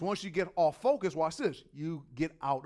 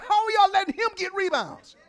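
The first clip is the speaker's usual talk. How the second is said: high, loud exasperated yell; opposing coach scolding his players, loud and high; mad coach yelling; 14 semitones higher, 13 dB louder than usual. Retold or imitated. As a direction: yell at your own team in disbelief. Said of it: "opposing coach was getting mad".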